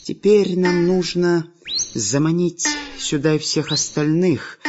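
slow, high, sly villain voice; scheming villain. Acted. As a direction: plot it out slowly, savouring. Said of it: "сказал главный повелитель растений". (коварный злодей)

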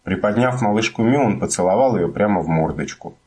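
ordinary delivery

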